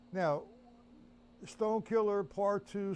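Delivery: normal talking voice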